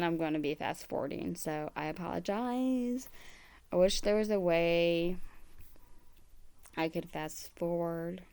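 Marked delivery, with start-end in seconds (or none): none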